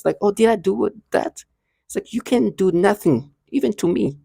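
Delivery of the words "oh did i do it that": high, innocent child voice imitation; mock-innocent little voice, pitched up; feigning a child's innocence, pitched high; childlike voice. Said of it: "they use this childlike voice".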